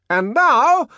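high, loud shout. Acted. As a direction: shout over deafening noise. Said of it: "the director shouted".

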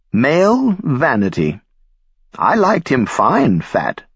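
put-on slow, high, trembling old woman; quavering voice. A narrator's reading. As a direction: draw it out with a quaver. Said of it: "Belda said in a quavering voice".

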